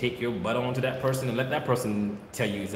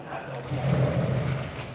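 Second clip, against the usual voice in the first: unvoiced whisper, very slow; whispered aside; much slower than usual. Real, not acted. Breeder whispering an aside while filming, slow. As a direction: breathe it out as an aside.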